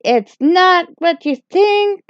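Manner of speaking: high, nervous backpedalling; flustered pleading. Acted. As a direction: scramble to explain, pitch climbing in a panic. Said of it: "So I was like".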